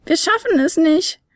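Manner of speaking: running out of time; despairing little flute. Acted. Fionella despairing while running.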